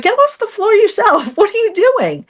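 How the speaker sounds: high, scoffing imitation of the husband; mock his dismissive tone way up high.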